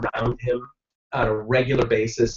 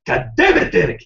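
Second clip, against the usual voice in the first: put-on German accent; 3 semitones higher than usual. The neighbor yelling at Derek in a German accent. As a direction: snap it at the boy like the neighbor. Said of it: "thick German accent".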